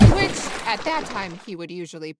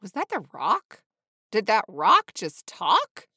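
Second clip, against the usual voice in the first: slow, loud disbelief, rising at the end; dramatic, mounting amazement; astonished gasp; 10 dB louder than usual. A narrator's reading. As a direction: gasp it out, stunned, word by word. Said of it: "gasped Sean".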